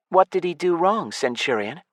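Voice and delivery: put-on elf girl. A narrator's reading. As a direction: ask it lightly, a young elf.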